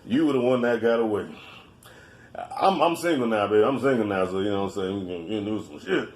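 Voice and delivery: hammed-up low drunk sweet talk. Drunk messaging women, retold.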